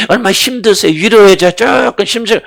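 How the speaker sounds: high, loud sing-song mockery of coddling; sneering high mock sympathy; mocking pampering voice. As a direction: mimic someone fussing over a weakling. Mocks worldly people needing comfort.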